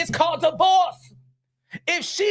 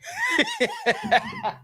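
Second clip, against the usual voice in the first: high-pitched burst of laughter; high cackle; 3 dB quieter than usual. Real, not acted. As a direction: cackle at your own joke. Big laugh after joke.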